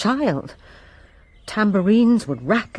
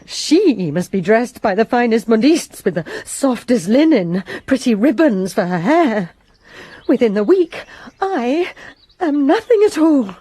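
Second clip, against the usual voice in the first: bitter outburst; 4 semitones higher, 5 dB louder than usual. Acted. Maid's bitter outburst about rival.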